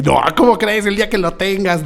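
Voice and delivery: high mocking voice of a teasing colleague; mock him lightly, like a friend ribbing you; teasing mimicry. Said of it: "se burla mucho de mí".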